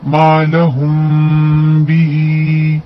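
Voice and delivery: मा लहुम बिही recited slowly, the म before ब hidden and nasal; slow tajweed recitation of मा लहुम बिही; ikhfa shafawi. Shown as a demonstration. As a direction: recite मा लहुम बिही with lips lightly parted on the म. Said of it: "इक्फा शफवी का कायदा होगा".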